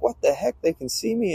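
high-pitched mock alarm; alarmed surprise. Flyer realizing the room can see him, high startled retelling. Suddenly visible, reacting in alarm.

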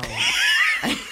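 shrieking real laugh; high burst of laughter. Real, not acted. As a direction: crack up in a high squeal. Friend cracks up at the story.

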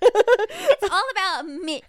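laughing, drawn out slowly. Pretend. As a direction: laugh through it, high and slow. Mocking co-host's birthday line.

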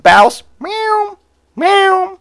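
very high, drawn-out meowing voice; Bouse the cat. Retold or imitated. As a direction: yowl slowly, stretching each word catlike. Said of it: "You know, that's Bouse".

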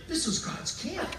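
high, amazed exclamation; exclaiming. Retold or imitated. Jacob seeing the angels, exclaiming high and amazed. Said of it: "he exclaimed".

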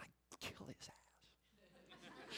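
hushed unvoiced whisper of the kids' verdict. Preacher whispering the kids' answer to the congregation. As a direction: breathe it like a secret.

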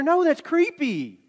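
high, slow, drawn-out refusal; mock appalled. Rejecting the photo idea, mock-appalled. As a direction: stretch it out as if appalled. Acted appalled answer to Cindy.

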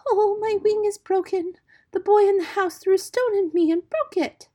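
high, loud wail of distress; shrill feigned cry for pity. Pretend. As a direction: wail like an injured little bird. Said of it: "and cried out".

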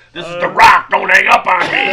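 loud hammed-up prank call; mock Rock voice. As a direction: shout it like a pushy prank caller. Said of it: "doing a little rock impression".

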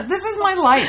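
high laughing cry of disbelief. Real, not acted. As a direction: laugh it out helplessly. Laughing at own misfortune.

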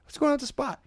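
high-pitched, put-on innocent query; Curb Your Enthusiasm. Shopper confronting a woman over her parking, Curb Your Enthusiasm style. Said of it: "It was like Curb Your Enthusiasm".

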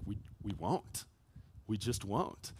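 barely above a murmur, unmoving; quiet stubborn refusal. Acted. The three men telling the crowd they won't bow, soft and stubborn.

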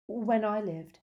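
stiff, formal admission; stiffly. A narrator's reading. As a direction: say it with stiff dignity. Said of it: "she said stiffly".

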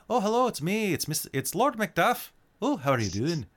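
high, lilting Scottish lord; Scottish accent. Shown as a demonstration. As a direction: greet them as a high-voiced Scot. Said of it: "my Scottish accent was a little".

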